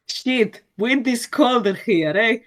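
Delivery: put-on loud, high narrator with heavy Spanish accent. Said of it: "with a very heavy Spanish accent".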